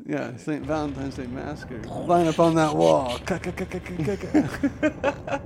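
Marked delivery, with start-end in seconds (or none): 1.8-3.2 loud, raised command; mock gunman order. Host acting the massacre, mock shouted order. 3.3-5.4 high rapid "ka" bursts; spray imitation gunfire, rising higher; machine-gun sound effect. Host acting out the massacre, mock gunfire. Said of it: "that's a good sound effect".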